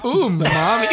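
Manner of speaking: high giggly echo of a moan; laughing mock moan.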